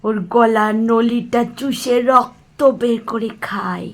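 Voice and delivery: low whispered craving for blood; hungry whisper. Acted. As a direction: breathe it out slowly, hungry for blood. (ফিসফিস করে রক্তের লোভ)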